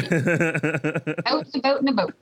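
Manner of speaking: slow, showy Canadian "out and about"; Canadian accent. Shown as a demonstration. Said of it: "The Canadian accent part".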